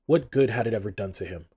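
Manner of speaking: usual speaking voice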